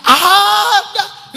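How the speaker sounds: high, loud, drawn-out yes; emphatic exclamation. Retold or imitated. Dog trainer answering a doubter, emphatic exclamation. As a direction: stretch it out high and loud with amusement.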